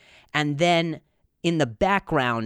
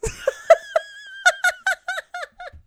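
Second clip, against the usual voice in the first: long high-pitched laughter; 3 dB louder than usual. Real, not acted. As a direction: crack up laughing. Laughing at own joke.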